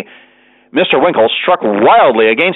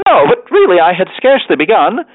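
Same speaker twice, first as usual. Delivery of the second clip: high-pitched mild protest; remonstrating; 6 semitones higher than usual. A narrator's reading. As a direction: protest in a higher voice. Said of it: "remonstrated Mister Winkle".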